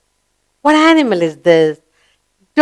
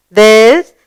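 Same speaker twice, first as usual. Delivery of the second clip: usual speaking voice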